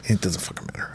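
hushed aside; whispering. Real, not acted. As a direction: whisper it under your breath. Aside dismissing a trivia point.

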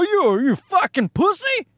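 high, incredulous jeering caricature; mocking pickup-truck guy. Pickup-truck guy taunting a vegan, high mocking jeer. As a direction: squeal the taunt as an over-the-top truck bro. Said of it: "people who drive pickup trucks and wear".

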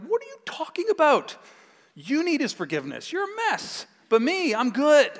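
high, scoffing self-righteous retort; smug good kid. Retold or imitated. Teen brushing off his brother, high and smug. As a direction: sneer like the kid who thinks he's better. Proud younger self mocking reply.

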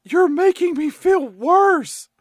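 mock wail, high, loud and slow; Crystal. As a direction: wail it like a weepy girl, hammed up. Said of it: "Crystal is crying and moping".

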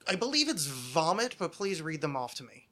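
usual speaking voice

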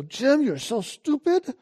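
high, slow mock put-down of himself; self-scolding voice. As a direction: sneer at yourself like a harsh inner critic. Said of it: "I'd go".

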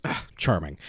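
low, soft, dry aside; understated sardonic mutter; dry quip. Retold or imitated. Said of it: "he just goes".

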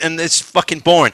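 ordinary talk, nothing marked

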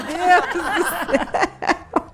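drawn-out delighted exclaim, laughing; excited laughing gasp. Real, not acted. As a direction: swoon with a laugh. Reacting to the guest's voice.

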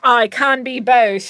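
put-on slow, proud English-accented repeat. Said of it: "fall into an English accent".

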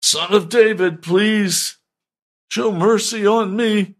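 high, insistent acted plea; crying out harder. Blind man over the scolding crowd, crying out harder. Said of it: "he just cried out all the more".

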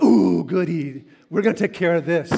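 gleeful at the fight ahead; eager glee. Retold or imitated. Recalling the call with mock glee. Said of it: "and I'm like".

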